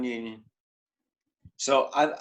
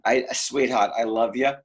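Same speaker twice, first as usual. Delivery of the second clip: normal talking voice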